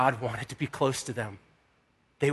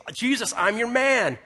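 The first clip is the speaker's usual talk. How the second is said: higher-pitched eager appeal; pleading; 6 semitones higher than usual. Retold or imitated. Man pleading his case to Jesus, eager and higher. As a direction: plead loyalty, pitch lifted. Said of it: "pleading your case".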